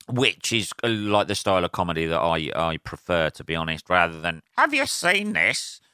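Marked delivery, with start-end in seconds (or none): 4.6-5.8 high, drawn-out mock stand-up question; observational comic parody. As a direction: send up a comic's slow rhetorical question. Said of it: "Peter Kay sort of, like, approach".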